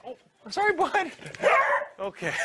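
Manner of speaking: high, sweet dog talk. Real, not acted. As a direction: coo at the puppy. Talking to dog on air.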